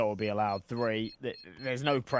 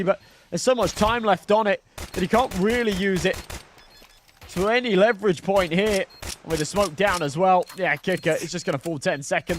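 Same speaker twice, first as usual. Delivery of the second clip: louder and higher, racing the action; hyped play-by-play; 8 semitones higher, 11 dB louder than usual. Acted. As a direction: shout the call over the crowd. Frantic clutch play-by-play.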